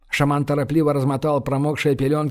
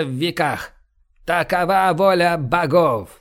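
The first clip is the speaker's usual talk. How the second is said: raised, solemn prophetic speech; booming biblical narration; 2 semitones higher than usual. Acted. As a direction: proclaim the gods' will. Shaman's prophecy continues.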